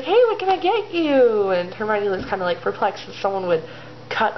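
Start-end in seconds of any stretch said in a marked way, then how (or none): 0.0-1.5 high, singing customer-service greeting; chirpy waitress. Acted. Rosmerta to customers at the table, chirpy. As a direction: take the order with a high, perky lilt.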